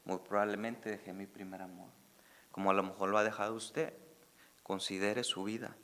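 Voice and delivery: low, soft and sincere appeal; soft reflective. Acted. Pastor quietly urging listeners to examine their lives. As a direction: speak it gently, inviting reflection.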